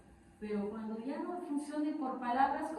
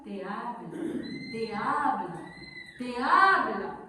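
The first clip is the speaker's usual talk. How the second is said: slow, drawn-out repeats rising in volume; building insistence; 6 dB louder, slower than usual. Shown as a demonstration. Preacher shows God speaking repeatedly, building insistence. Repeated words escalate like warnings.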